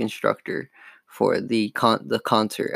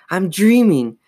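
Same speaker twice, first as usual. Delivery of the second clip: high, loud disbelief; raised, urgent self-talk; telling herself aloud; 7 semitones higher, 5 dB louder than usual. A narrator's reading. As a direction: insist it to yourself in alarm. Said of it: "Chris told herself aloud".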